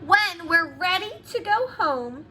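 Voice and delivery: ordinary delivery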